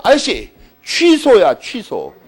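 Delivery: loud, slow, drawn-out shout of "취소야"; hammed-up victorious yell at the lender; triumphant shout. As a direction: burst in yelling the news. Bursting through gate shouting.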